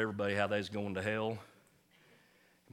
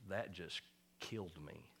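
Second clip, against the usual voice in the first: soft, hushed, as if the words just killed her; crushed, heavy tone; 3 semitones lower, 11 dB quieter than usual. Retold or imitated. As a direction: quietly voice the old lady's hurt, softer. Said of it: "her tone of voice was like".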